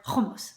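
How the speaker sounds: hummus opened with a scraped kh; guttural mispronunciation. Shown as a demonstration. Cook showing the other wrong version, guttural. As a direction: say hummus as KHO-mus. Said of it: "instead of".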